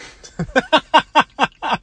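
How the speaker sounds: high, loud burst of cackling; real cackle. Real, not acted. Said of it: "why I just hackled".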